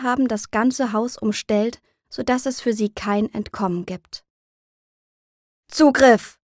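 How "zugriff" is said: loud, sharp military order; commander's raised-voice attack order; roared command. A narrator's reading. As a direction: shout the order to the squad. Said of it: "brüllt der Anführer seinem Trupp zu".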